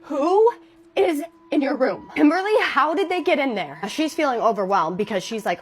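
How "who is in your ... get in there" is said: higher, louder, alarmed calling out; panicked nurse shouting over the intercom; startled shout of disbelief. Retold or imitated.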